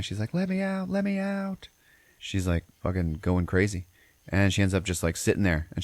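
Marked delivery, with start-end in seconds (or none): 0.3-1.7 mock screaming plea. Teller voices trapped woman screaming and crying, mock wail. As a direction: imitate a wailing cry without real volume. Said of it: "she's screaming and crying".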